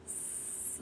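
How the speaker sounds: long hissed sss, no voice; the letter S as a sustained "sss"; snake sound. Shown as a demonstration. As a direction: hiss it like a snake. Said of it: "like a snake".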